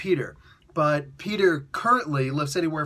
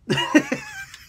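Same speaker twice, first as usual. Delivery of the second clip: loud high cackle; 4 dB louder than usual. Real, not acted. Laughing at own joke.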